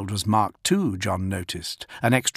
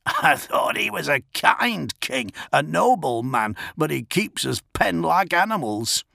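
put-on grumbling old man; 6 semitones higher than usual. Old man complains about king.

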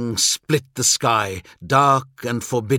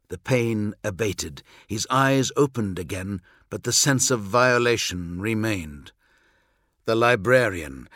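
usual speaking voice

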